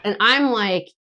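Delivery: normal talking voice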